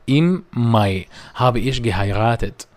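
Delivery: am nächsten Sonntag said very slowly and carefully; slow German demo. Shown as a demonstration. Teacher models German sentence two for students, very slowly. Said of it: "الجملة التالتة بتقول".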